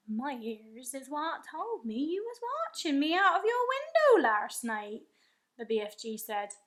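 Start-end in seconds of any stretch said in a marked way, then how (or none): 0.1-5.1 a big-voiced giant, higher and louder, marvelling; the giant boasting of his hearing with wonder; friendly giant voice. A narrator's reading. The BFG telling Sophie what he hears, in a giant's voice.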